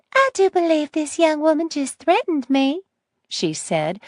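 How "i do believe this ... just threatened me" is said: put-on high, sweet little old lady. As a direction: innocent sweet grandma tone. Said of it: "the sweet little old lady voice back in place".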